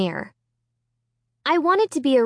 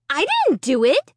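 protesting; 6 semitones higher than usual. Acted. Girl denies.

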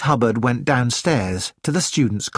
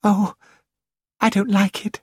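put-on higher, mildly alarmed girl's voice; a small gasp of dismay, pitched up; 6 semitones higher than usual. A narrator's reading. Frightened girl reacting to the ink bottle, mild gasping dismay. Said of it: "The girl in the coat gasped".

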